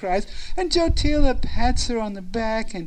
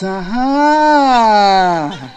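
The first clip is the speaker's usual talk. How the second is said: long, loud drawn-out exclamation; hammed-up "gotcha" cry; gleeful discovery; 7 dB louder than usual. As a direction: stretch it out as a big reveal. Everyone sees the flowers fall out.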